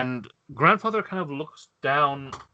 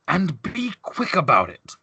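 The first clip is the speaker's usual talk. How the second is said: higher, drawn-out call after someone; mock shout; 5 semitones higher than usual. Blue man calls after the departing player, half-shouted order. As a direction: call it out imperiously without full volume. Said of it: "the blue man shouts behind you".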